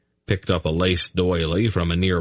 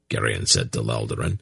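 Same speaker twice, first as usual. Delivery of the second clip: plain reading voice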